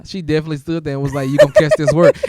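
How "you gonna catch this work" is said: loud mock warning; retold threat. Podcaster imitating the sister's warning, loud retold threat. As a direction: put force into the quoted threat.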